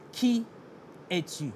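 ordinary talk, nothing marked

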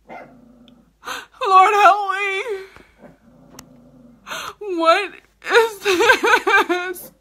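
high, drawn-out laughing wail; whimpering a fake cry to the heavens; mock despair. Trying old lipstick, she wails in mock despair.

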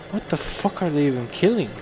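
ordinary talk, nothing marked

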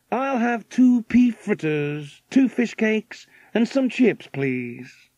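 high, drawn-out American customer; American millionaire. A narrator's reading. American customer ordering from the waitress, high character voice.

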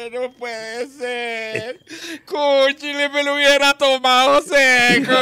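high, slow wailing regret; mock lament. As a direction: wail over the lost drink. Drunk laments the lost whisky.

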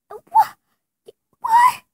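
very high, shocked squeal; shocked squeak. Acted. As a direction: squeak it in disbelief. Fidgets turned out fake.

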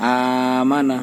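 আমানা with the first আ drawn out very slowly; madd lengthening. Shown as a demonstration. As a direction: stretch the opening আ of আমানা by one alif. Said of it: "আমানা বলতে হবে, প্রথমটা একালিপ টান হবে". (আমানা প্রথম আ এক আলিফ টেনে ধীরে)

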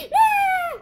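sudden high loud scream; high loud yelp; startled shout. Real, not acted. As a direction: shriek as the snake nearly hits. Near death in game.

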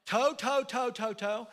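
put-on toddler babble. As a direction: chatter like an excited grandson. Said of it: "then he starts going".

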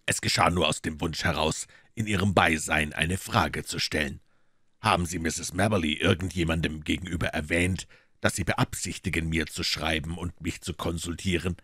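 cool detective teasing the maid; dry detective. Acted.

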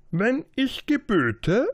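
high, loud, slow royal command; a proud king beckoning his subject. Acted. King's saying.

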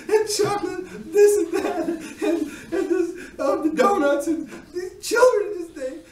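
overacted tearful rant about fat children; hammed-up blubbering. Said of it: "my crying and blubbering".

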